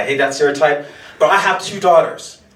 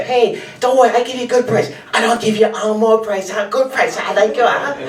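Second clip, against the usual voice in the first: higher Singlish street-vendor auntie voice; Singaporean auntie accent; 6 semitones higher than usual. Retold or imitated. Auntie vendor coaxing a customer, Singaporean accent. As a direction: sell it like a Singaporean hawker auntie. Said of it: "you see this auntie, like, say".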